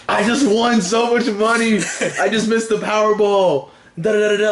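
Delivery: reliving the thrill of the win, a bit hammed-up; excited. Retelling his lottery win.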